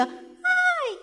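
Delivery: a thin squeaky "¡Ay!" pitched far up; feeble mock cry. Shown as a demonstration. Preacher mocks a weak shriek, "¡Ay!" squeaked very high. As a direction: squeal "¡Ay!" as the wrong kind of scream. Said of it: "Pero no era un grito".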